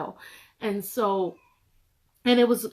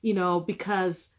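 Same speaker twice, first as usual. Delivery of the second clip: ordinary talk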